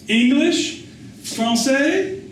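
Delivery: high, calling out to a stranger; eager street boy. Acted. Boy touting to a foreigner.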